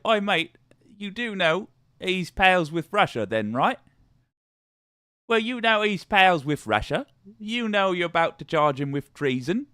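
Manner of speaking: high-pitched cockney-style British, drifting Australian; put-on British accent. Foreigners questioning America about Russia, mock British accent. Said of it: "British accent I've ever heard".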